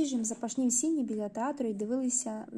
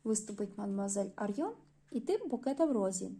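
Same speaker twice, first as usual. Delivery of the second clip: ordinary delivery, nothing marked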